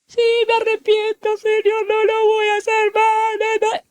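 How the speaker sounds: hammed-up penitent whine; mock tearful repentance. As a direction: imitate a worshipper promising God to change. Mimics worshipper repenting in prayer.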